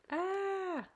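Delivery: high, loud scream of fright; startled scream. Acted. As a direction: shriek in sudden fear. Customer screams again.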